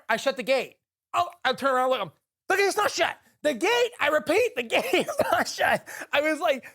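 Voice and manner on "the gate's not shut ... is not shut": high, emphatic retold radio call; mock insistence. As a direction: insist over the radio, half laughing.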